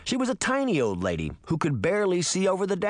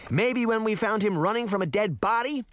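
higher-pitched sarcastic retort; snapping; 7 semitones higher than usual. A narrator's reading. Sabrina blaming Puck mid-argument, snapping sarcastically. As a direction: snap it back with sarcasm. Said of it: "Sabrina snapped".

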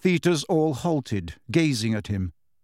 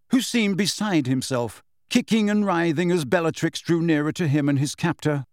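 plain reading voice

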